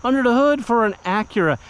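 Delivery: a hint of a let-down customer scoffing; skeptical buyer. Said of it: "And then you go".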